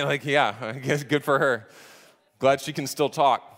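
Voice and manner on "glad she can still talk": ordinary talk, nothing marked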